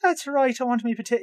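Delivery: high-pitched stage-Irish; exaggerated Irish accent. Host answering the potato joke, exaggerated Irish accent. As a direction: lay the Irish on thick. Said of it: "I do the exaggerated one".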